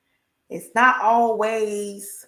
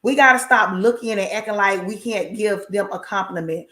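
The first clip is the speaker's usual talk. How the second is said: normal talking voice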